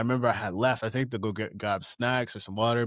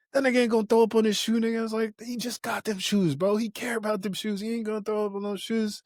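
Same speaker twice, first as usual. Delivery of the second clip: mock insistence; 9 semitones higher than usual. Defending friend to the group.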